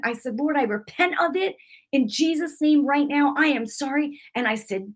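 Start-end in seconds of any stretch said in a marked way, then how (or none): 0.4-4.2 high, earnest plea of repentance; fervent prayer. Retold or imitated. Woman apologising to the Lord, fervent retold prayer. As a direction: pray it urgently, pitch held up. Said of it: "I got mad and I went in the room".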